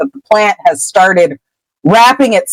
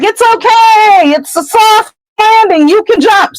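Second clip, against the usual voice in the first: reassuring holler sent far upward; yelling up from below. Retold or imitated. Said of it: "he yells up".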